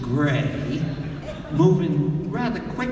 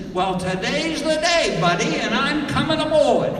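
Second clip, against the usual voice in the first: loud, high, domineering holler; bossy woman; 9 semitones higher, 4 dB louder than usual. Retold or imitated. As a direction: boom it down the dock. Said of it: "she says this".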